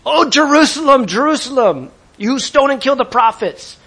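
high, loud anguished cry; Jesus wailing aloud. Acted. Preacher acts Jesus sobbing over Jerusalem, loud high wail. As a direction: wail it out over the whole city. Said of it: "This is a sob where you're wailing aloud".

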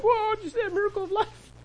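high, excited gasp of wonder; amazed parents. Retold or imitated. Parents marvel at their newborn, high and amazed. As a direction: marvel at it like a thrilled new parent. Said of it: "the parents are like".

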